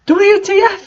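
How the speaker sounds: very high, indignant yelp; hammed-up outrage; mock angry. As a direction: spit out the letters. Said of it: "he asked angrily".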